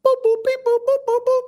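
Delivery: high robotic boop boop beeps; computer beeps. Retold or imitated. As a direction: beep like a computing brain. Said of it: "It's like a computer".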